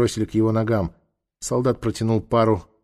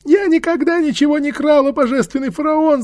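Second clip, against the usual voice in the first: very high, whining crone voice; whimpering old woman; 5 dB louder than usual. A narrator's reading. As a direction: whine out a plea of innocence. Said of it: "захныкала она".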